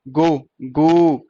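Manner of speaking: गो, गो with ओ held long; very slow, separate गो, गो; stretched vowel. Shown as a demonstration. Teacher showing ओ inside a word, stretched vowel. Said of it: "गो की sound आ रही है".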